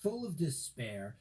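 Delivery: ordinary delivery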